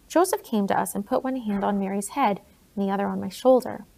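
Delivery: ordinary delivery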